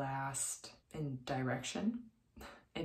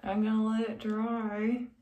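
lips pulled back wide; through clenched teeth; 6 semitones higher, 7 dB louder than usual. Real, not acted. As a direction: teeth bared while talking. Seen 1: Brush out of mouth; lips pulled back, teeth clenched to dry.

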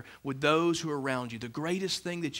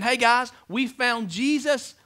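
mock announcement; 7 semitones higher, 3 dB louder, slower than usual.

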